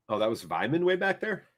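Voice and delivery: ordinary talk, nothing marked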